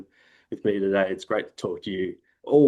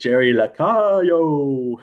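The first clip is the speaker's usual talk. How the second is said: slow, stretched name call, pitched up; baseball announcer voice; 5 semitones higher, 3 dB louder, much slower than usual. Shown as a demonstration. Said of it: "baseball announcer voice".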